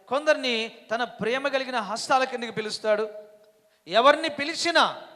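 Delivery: loud, high fiery sermon; preaching at full force. Acted. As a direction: drive each line home to the crowd.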